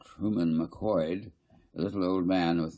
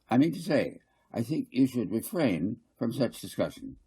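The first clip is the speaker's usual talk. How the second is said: fussy councillor Garth; 4 semitones higher than usual. Acted. Garth lecturing the council, higher and insistent. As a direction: press the point, repeating I mean to say.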